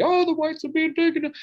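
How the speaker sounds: high, mocking whine of a scared conservative; mock panicked conservative. Mocking the right-wingers' panic in a high put-on voice. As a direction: squeal it like a panicking bigot.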